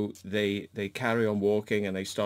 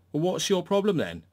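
imitating her retort; 8 semitones higher, 3 dB louder than usual. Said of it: "She goes".

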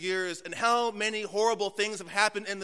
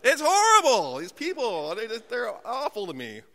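hammed-up cry of horror; mock outrage; 3 semitones higher, 4 dB louder than usual. Pastor complaining about airlines, loud mock outrage.

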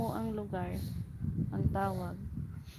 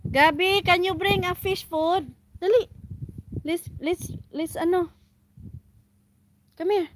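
calling out; 7 semitones higher, 8 dB louder than usual. Real, not acted. Calling child to bring food.

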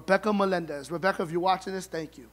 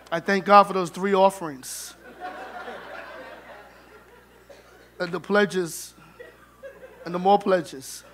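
testimony litany. Acted. Preacher-style litany of thanks.

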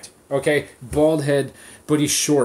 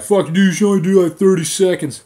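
loud, higher-pitched imitation of a critic; mock scoffing; 4 semitones higher, 6 dB louder than usual. Other gym guys objecting to his minute-long break, mock scoffing. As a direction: put on a mocking gym-bro objection.

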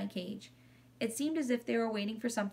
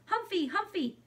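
put-on shouting toddler; 10 semitones higher than usual. A narrator's reading. Little brother toddles to the table shouting, high toddler voice.

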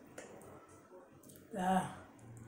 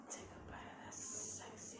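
sultry whisper; 20 dB quieter than usual. Acted. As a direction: whisper it like a temptation. Whispered food tease.

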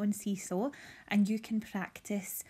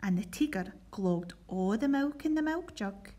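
put-on lively read-aloud storytelling for children; reading a Scots picture book to pupils; story narration. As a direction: tell it brightly to a school assembly. Teacher reads Scots story aloud.

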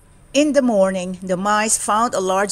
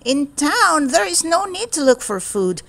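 mild grumble; 7 semitones higher than usual. Acted. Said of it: "he grumbled".

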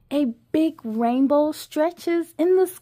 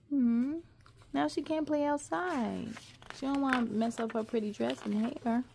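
ordinary delivery

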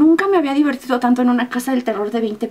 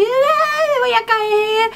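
very high, panicky whine; mock fright; 11 semitones higher, much slower than usual. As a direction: squeal as if slipping from the ride. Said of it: "yo siento que me caigo así".

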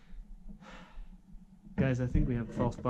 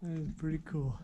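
normal talking voice

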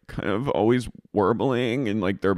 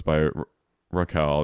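ordinary talk

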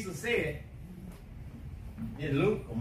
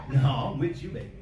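lower, fast sneering demon voice; taunting demon; 7 semitones lower than usual. Pretend. The demon taunting the believer at home, low fast and sneering. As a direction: gloat like a demon caught you. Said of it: "that demon began to manifest".

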